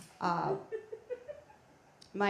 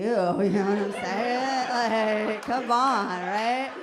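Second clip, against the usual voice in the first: loud laughing appeal to the crowd; 2 semitones higher, 9 dB louder, much slower than usual. Real, not acted. Comic asking the audience to agree, laughing loudly.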